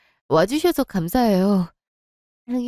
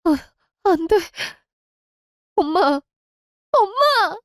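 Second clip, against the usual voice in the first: anguished wail; 10 semitones higher than usual. Acted. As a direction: break down calling her mother. Mother just died.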